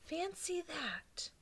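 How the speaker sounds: high whispered marvel; old lady's hushed amazement; whispered wonder. Acted. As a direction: breathe it in awe.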